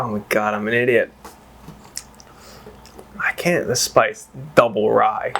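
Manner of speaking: usual speaking voice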